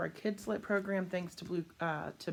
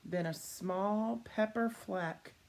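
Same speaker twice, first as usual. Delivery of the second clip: put-on reading to children; 2 semitones higher, slower than usual. A narrator's reading. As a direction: sell every rhyme to the kids. Reading picture book to children.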